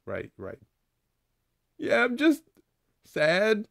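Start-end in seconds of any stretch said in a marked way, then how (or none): 1.8-3.7 high, small sad squeak; a high cartoon kid, a little down; high squeaky character. Laffy Taffy admits sadness.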